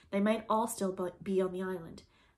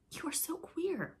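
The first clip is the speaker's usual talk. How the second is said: puzzled; 10 semitones higher, slower than usual. A narrator's reading. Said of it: "frankly puzzled".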